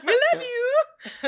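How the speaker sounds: very high, loud, drawn-out gush; playful fan cry. Pretend. Co-host calling to favourite podcasters, mock adoring cry. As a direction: call it out slowly like a giddy fan.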